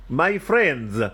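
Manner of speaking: put-on mayor's Italian-accented speech opening. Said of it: "in an Italian accent".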